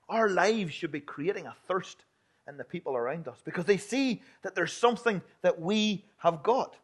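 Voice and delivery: normal talking voice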